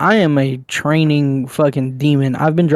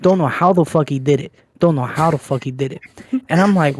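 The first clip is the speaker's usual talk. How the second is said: usual speaking voice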